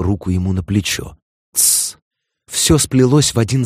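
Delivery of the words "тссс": a soft hissed shush. Acted. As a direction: hush him quietly. Lawyer hushing client in court.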